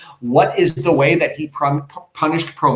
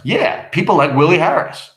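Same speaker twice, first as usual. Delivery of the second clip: emphatic acted comeback, a bit raised; defiant retort; 3 semitones higher, 4 dB louder than usual. Husband answering his weary wife, mildly defiant. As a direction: throw it back with more force.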